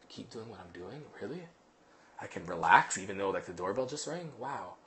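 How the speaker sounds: soft, low wondering dog voice; hushed, as if a dog can barely believe it; dog's thoughts. Retold or imitated. Trainer voicing a dog told good, softly amazed. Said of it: "The dog".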